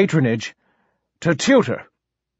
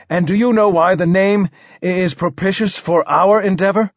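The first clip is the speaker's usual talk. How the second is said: higher-pitched, questioning monarch; stuttering king; 5 semitones higher than usual. Acted. King prompts poet about the name, higher royal voice. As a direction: lead the listener on in a higher voice.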